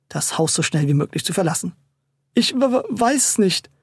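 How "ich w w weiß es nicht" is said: high, uncertain stuttering; stammering, unsure. A narrator's reading. Frightened landlady answers the mother, stammering unsurely. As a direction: trip nervously over the first sound. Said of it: "stammelte sie mit unsicherer Stimme".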